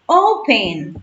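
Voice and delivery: "Open" with a strong long O, falling away; stress on first syllable. Shown as a demonstration. Teacher demonstrates the accent for students, "Open" stressed on O. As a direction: lean on the O of "Open". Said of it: "su acento se encuentra en O".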